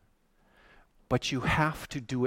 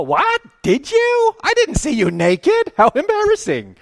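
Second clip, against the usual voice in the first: much higher, louder, squeaky disbelief; baffled sheep; 11 dB louder than usual. Retold or imitated. Confused sheep answering the king, squeaky and flustered. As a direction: play the sheep as flustered and shocked. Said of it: "all of the sheep go".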